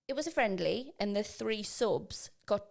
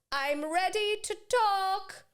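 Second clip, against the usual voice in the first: mock-posh child, high and loud; slow, drawn-out posh announcement from a child; 12 semitones higher, 6 dB louder, slower than usual. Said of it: "in this, like, really posh voice".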